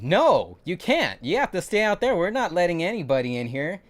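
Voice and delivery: high, loud shout through the door; firm refusal. Retold or imitated. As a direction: bark him back outside. Refusing burned man entry at door.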